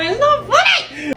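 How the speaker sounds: slow, laughing through the words; laughing protest. Real, not acted. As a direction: protest while cracking up. Wife laughs while protesting.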